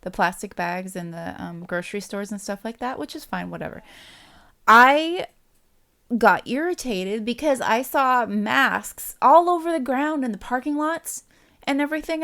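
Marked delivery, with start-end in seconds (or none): 4.7-12.2 higher, louder, slower annoyed venting; irritated. Real, not acted. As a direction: vent your irritation. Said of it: "That really irritated me".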